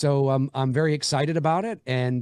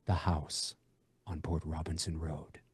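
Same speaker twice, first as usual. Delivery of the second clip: low, soft movie-trailer narrator; deep dramatic trailer read with a pause after house; 9 semitones lower, 11 dB quieter than usual. Shown as a demonstration. Filmmaker demos his horror trailer voice, low and dramatic. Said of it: "it would be more like".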